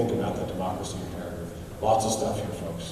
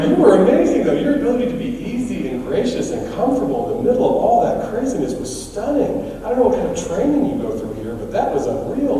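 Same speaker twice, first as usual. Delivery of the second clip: gushing praise; 9 semitones higher than usual. Retold or imitated. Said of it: "And I go".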